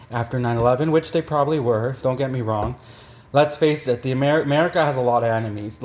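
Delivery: ordinary delivery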